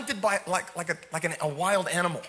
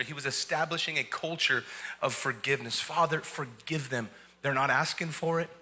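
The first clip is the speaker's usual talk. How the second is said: lower, softer, gentle closing over music; soft closing appeal; 6 semitones lower, 4 dB quieter than usual. Acted. Pastor gently appealing to the morning congregation, soft and low. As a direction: ease the room into forgiveness, quietly.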